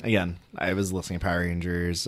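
ordinary talk

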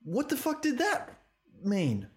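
high incredulous question; what on earth was that; baffled. Real, not acted. Baffled by the dance scene.

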